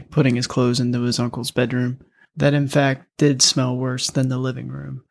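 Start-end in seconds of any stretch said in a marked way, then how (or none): none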